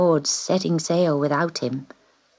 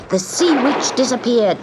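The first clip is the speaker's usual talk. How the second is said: usual speaking voice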